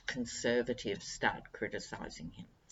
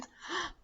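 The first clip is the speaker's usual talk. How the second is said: dramatic gasp; 6 dB quieter than usual. Acted. Sudden gasp before anecdote.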